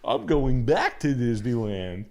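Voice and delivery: loud, high cartoon dog, cracking up; hammed-up Goofy pilot; loud laughing Goofy. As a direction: boast through giggles as the mascot. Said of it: "it's him now".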